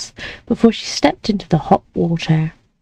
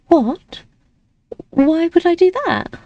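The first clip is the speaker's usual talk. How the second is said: higher, disbelieving why; surprised protest; 10 semitones higher than usual. Acted. Lady protests maid's advice, higher and startled. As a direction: push back in shock.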